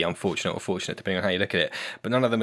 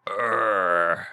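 slow, growling monster accent; grunt, then announce yourself slowly in a gruff voice; gruff bugbear; much slower than usual. Shown as a demonstration. Player showing how the party does big accents, gruff bugbear. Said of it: "mainly just, like, gruff and".

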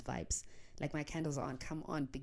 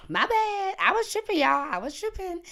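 much higher and louder, overexcited; excited outburst; 11 dB louder than usual. Real, not acted. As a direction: burst out, laughing off the panic. Laughing at her own panic.

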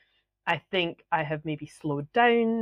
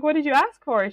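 high, louder astonished question; retold incredulity; 10 semitones higher, 5 dB louder than usual. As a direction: press the friend, higher and louder. Retelling her incredulous question to friend.

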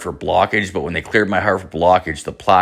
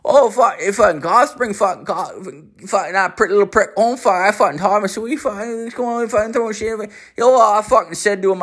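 put-on higher, fast, mumbled Newfoundland accent; thick Newfoundland accent; 11 semitones higher than usual. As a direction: rattle it off in a thick Newfoundland accent, swearing every word. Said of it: "this Newfoundland guy".

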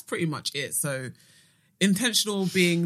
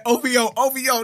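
louder, higher mock chant of the friend; 6 semitones higher, 7 dB louder than usual. Teasing the absent friend who reps her star sign, mock chant. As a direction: parrot the Scorpio friend with a teasing sing-song. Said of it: "She said".

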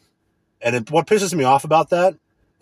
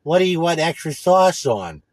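slow, put-on voice of the clerk; mock phone clerk, slow and snide; mocking employee; 4 semitones higher than usual. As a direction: mimic the clerk's question, drawn out. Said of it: "they call and like".

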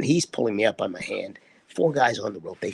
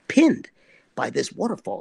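ordinary delivery

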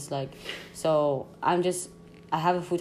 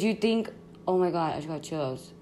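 ordinary talk

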